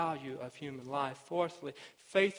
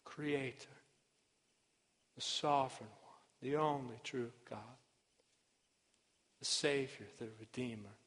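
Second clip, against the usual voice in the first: measured, hushed appeal to the congregation; solemn sermon close; 2 semitones lower, 5 dB quieter than usual. Real, not acted. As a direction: bring the sermon down to a quiet, weighty close. Preacher's solemn closing appeal.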